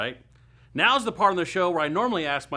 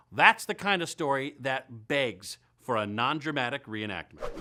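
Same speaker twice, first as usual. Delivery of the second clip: usual speaking voice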